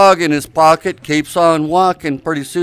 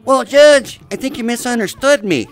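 a lightly voiced, higher cartoon mouse; Mickey Mouse; 6 semitones higher than usual. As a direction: pitch it up slightly for a mouse in court. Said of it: "Mickey says".

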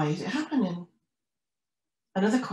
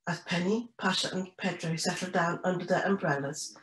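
usual speaking voice

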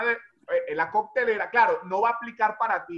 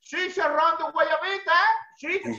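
higher, slow hawker's call; vendor calling again; 6 semitones higher, slower than usual. Retold or imitated. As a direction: sing it out to the street. (pregón alargado y agudo)

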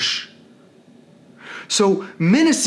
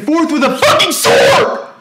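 rising into a furious shout; real exasperated outburst; angry yell; 8 semitones higher, 5 dB louder than usual. Real, not acted. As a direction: burst out louder and higher at the end. Rant builds to a yell.

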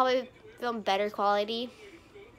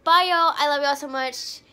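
excited goodbye; 3 semitones higher, 8 dB louder than usual. Real, not acted. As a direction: call out a bright farewell. Cheerful vlog sign-off.

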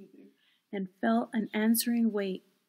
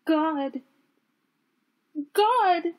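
high, loud cries of shock; horrified; 9 semitones higher, 10 dB louder than usual. A narrator's reading. Eleanor flinging herself out of bed, horrified. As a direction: cry out in mounting horror.

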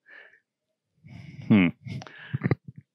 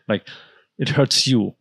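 ordinary talk, nothing marked

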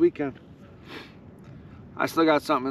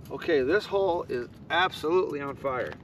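normal talking voice

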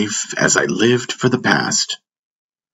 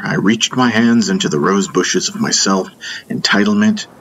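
plain reading voice

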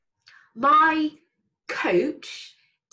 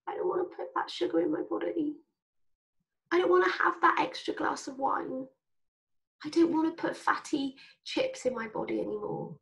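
higher, small, partly whispered voice; a quiet little conscience talking back to cravings; small high voice of reason, softly; tiny sensible voice; 5 semitones higher, 3 dB quieter than usual. Retold or imitated. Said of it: "my prefrontal cortex, that goes".

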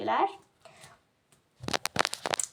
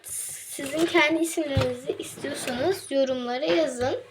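usual speaking voice

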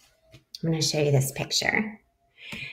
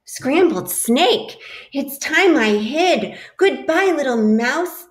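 alarmed snake; 8 semitones higher, 5 dB louder than usual. Acted. Snake frightened, flees.

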